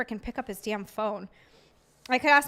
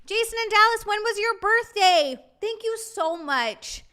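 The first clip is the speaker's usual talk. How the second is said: higher, louder announcing shout-out; excited call-out; 8 semitones higher, 4 dB louder than usual. Real, not acted. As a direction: call the names out brightly. Calling out to birthday viewers.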